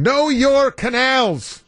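high, drawn-out quiz title; game-show host. Pretend. Host naming a made-up game, mock game-show announcer. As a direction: sing out the game's name like a host.

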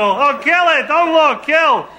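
hammed-up shrieking wife; mock hysterical. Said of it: "she's hysterical".

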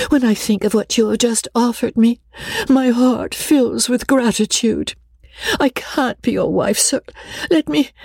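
high-pitched, pleading governess; pathetic, high-voiced entreaty; exquisite pathos. A narrator's reading. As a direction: implore him with tearful gratitude, pitched high. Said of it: "looked up in his face with exquisite pathos".

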